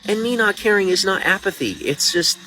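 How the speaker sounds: high, strained and emotional; distraught. Real, not acted. Upset streamer pleading about his hard life.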